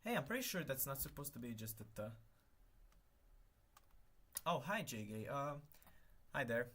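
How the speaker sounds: ordinary talk, nothing marked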